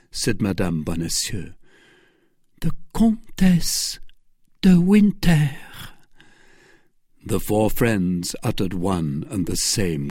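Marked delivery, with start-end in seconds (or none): 2.6-5.6 high, failing woman's voice; dying voice. A narrator's reading. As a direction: force the name out bit by bit. Dying woman names her poisoner.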